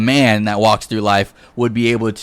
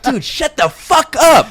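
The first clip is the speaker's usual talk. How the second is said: very high-pitched, hammed-up outburst; mock exasperation; 4 dB louder than usual. Girlfriend silencing her pestering man in bed, mock exasperated. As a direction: cut him off in a squealing, exaggerated fed-up voice. Acts out the fed-up girlfriend's reply.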